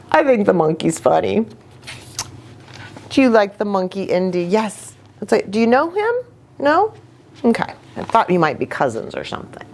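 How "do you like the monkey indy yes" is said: usual speaking voice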